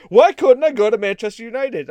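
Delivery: upset player. Retold or imitated. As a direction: play the sulking star player, pleading. Upset player voiced in retold story.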